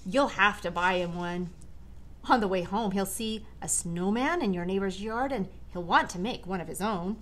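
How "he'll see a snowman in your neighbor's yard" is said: usual speaking voice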